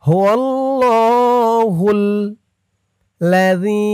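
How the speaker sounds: the ذي of الذي held one alif length; one-count stretch. Shown as a demonstration. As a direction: give الذي a short single-count madd. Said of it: "এক আলিফ টান".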